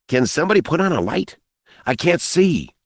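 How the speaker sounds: put-on high, hurried young voice; boy calling out. A narrator's reading. Boy stumbling in darkness, calling out.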